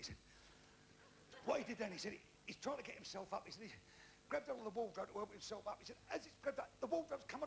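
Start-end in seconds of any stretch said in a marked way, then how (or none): none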